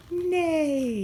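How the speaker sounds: whinny it like a horse; horse neigh. Pretend. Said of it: "What does a horse say?".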